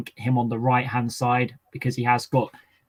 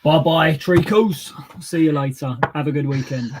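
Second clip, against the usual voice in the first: put-on louder, higher Cockney barrow-boy voice; Cockney accent; 4 semitones higher, 5 dB louder than usual. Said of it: "say bye in a Cockney accent".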